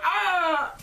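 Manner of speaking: sudden high yelp, like getting hit; a quick, high, startled shout; mock stormtrooper yelp. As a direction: squeal out a short hurt cry. Stormtroopers hit by Ewok rocks.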